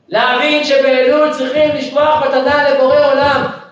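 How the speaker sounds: loud, high sermon cry; loud high preaching; preaching at full force. Acted. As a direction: rouse the crowd about Elul. Preaching climax.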